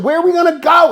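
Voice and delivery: high, loud protest; retold worked-up demand; exasperated shouted question. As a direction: throw the question at him, incredulous. Retold frantic exchange.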